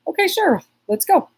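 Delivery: high, lilting retold yes; breezy agreement. Telling how she follows the flow, breezily agreeable. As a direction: pitch it way up, game for anything.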